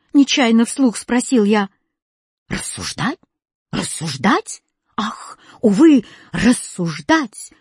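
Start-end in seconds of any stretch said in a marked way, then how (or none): 2.5-4.6 low, rising incredulous questions; incredulous exclaiming. A narrator's reading. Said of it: "выкрикивал восклицательный". 5.0-7.5 slow, wailing exclamation; lament, drawing the words out; crying out. A narrator's reading. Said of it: "выкрикивал восклицательный".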